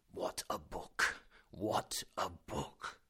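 slow, hushed awed murmur; lost in the book, repeating it slowly; rapt professor muttering. A narrator's reading. Professor to himself, hushed and awed. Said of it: "he kept saying".

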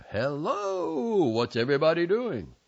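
loud, high booming hello; big shouted uncle's entrance; yelled greeting. A narrator's reading. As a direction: holler it to the whole room. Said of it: "my uncle walked in and yelled".

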